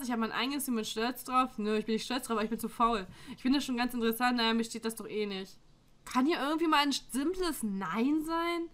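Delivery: normal talking voice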